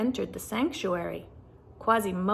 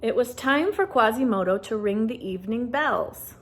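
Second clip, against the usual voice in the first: ordinary delivery, nothing marked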